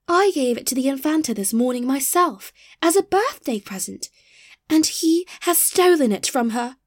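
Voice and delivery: high, aggrieved complaint; exclaimed. A narrator's reading. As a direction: protest it as the wronged party.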